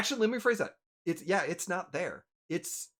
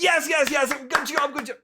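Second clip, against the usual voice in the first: loud, high rapid approval; happy, excited father; 8 semitones higher, 7 dB louder than usual. Acted. Father praising daughter's greeting, excited and high. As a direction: cheer on the daughter with eager repeats. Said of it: "full-on happy person".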